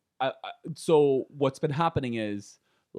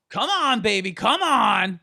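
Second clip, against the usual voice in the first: put-on high, louder coaxing old man; 8 semitones higher, 5 dB louder than usual. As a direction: coax like an East Coast old man. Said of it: "It was just like".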